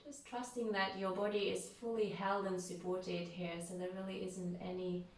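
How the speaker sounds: unhurried, calm yoga nidra voice with long pauses; guided meditation. Real, not acted. Leads a guided relaxation.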